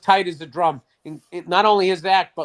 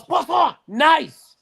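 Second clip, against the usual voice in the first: put-on high, punched English-accent exclamation; hammed-up English-accented delight; mock English accent; 7 semitones higher, 4 dB louder, much slower than usual. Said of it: "he says it in an English accent".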